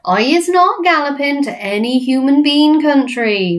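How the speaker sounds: high, loud giant denial; friendly giant, protesting. Acted. BFG denying to giants, protesting giant voice.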